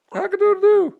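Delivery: rooster crow. Shown as a demonstration. Parent answering their own rooster question for Toby with a loud high crow. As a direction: belt out a rooster's crow for the kid. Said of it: "How about a rooster?".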